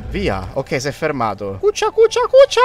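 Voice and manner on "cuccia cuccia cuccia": high-pitched. Real, not acted. Telling the beast to sit.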